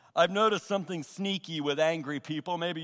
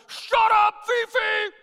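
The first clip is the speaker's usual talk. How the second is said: high, loud shout at a dog; acted furious dog owner yelling at full volume; top-of-lungs yell; 7 dB louder, slower than usual. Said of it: "top of his lungs, yells".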